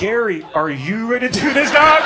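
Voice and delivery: rising to a loud, high disbelief; incredulous comeback. Retold or imitated. Client doubting his overweight trainer, loudly incredulous. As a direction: throw the question back, climbing loud on the end.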